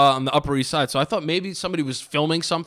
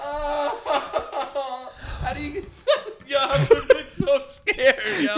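laughing; 7 semitones higher, 3 dB quieter, much slower than usual. Real, not acted. Helpless laughter.

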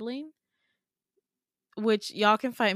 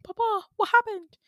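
very high, louder hammed-up British accent; 12 semitones higher, 5 dB louder than usual. Kid calling to Papa for answers, exaggerated high British accent. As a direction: play up a high, mock-British cry for Papa. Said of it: "in a British accent".

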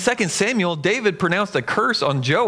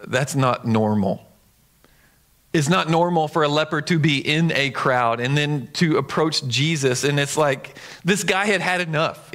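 ordinary talk, nothing marked

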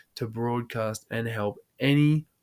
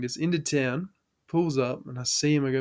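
ordinary delivery

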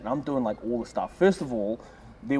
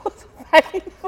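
high sympathetic wince; 7 dB louder, slower than usual. Real, not acted. High far drawn-out remark.

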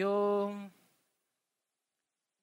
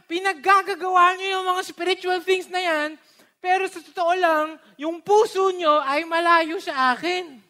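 higher and louder, God rebuking His people; angry preacher voicing God's rebuke of Isaiah's people; 9 semitones higher, 7 dB louder than usual. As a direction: scold them like an offended God. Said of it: "galit na galit na galit".

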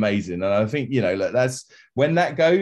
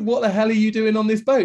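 high, louder mock disbelief; incredulous outburst; 13 semitones higher, 4 dB louder than usual. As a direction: blurt it out high in mock shock. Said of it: "It's like".

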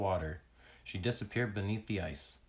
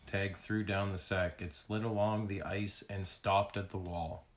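usual speaking voice

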